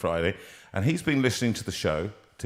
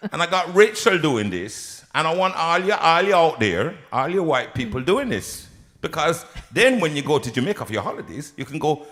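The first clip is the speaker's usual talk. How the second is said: higher, louder put-on Jamaican; Jamaican accent; 6 semitones higher, 4 dB louder than usual. Host urging everyone out there to join in, in a Jamaican accent. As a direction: exuberant mock Jamaican teacher. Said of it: "How do you speak Jamaican".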